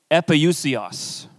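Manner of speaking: "epousios" said slowly, eh-poo-see-os, falling at the end; Greek word. Shown as a demonstration. Pastor teaching the congregation the Greek word "epousios" slowly. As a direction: sound out "epousios" carefully syllable by syllable. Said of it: "the actual Greek word is".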